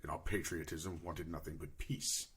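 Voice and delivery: low, measured tale-telling in character; solemn war-orphan adventurer. Acted. Player tells character's backstory.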